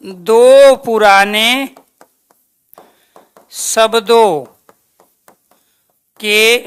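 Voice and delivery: slow, word by word, long pauses between; slow dictation. Shown as a demonstration. Teacher dictating the function of sandhi slowly to students. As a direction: spell it out slowly for note-taking.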